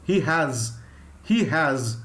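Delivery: "has" ending in a buzzing z. Shown as a demonstration. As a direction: say "he has" with z, not s. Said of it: "Z sound".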